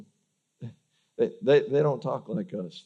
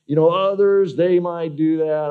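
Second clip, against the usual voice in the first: smug self-righteous; 6 semitones higher, 10 dB louder than usual. Retold or imitated. Self-righteous boast mocked.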